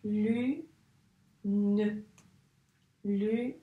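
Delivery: "Lune" as lu, pause, nne; slow split "Lune", n hummed; syllables separated. Shown as a demonstration. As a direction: dictate "Lune" in two held syllables. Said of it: "il y a deux syllabes".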